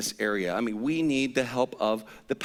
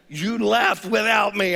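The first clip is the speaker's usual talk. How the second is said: high, loud, slow hurt protest; wounded complaint; 7 semitones higher, 6 dB louder, slower than usual. Retold or imitated. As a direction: stretch it out, feeling stranded. Confronting wife.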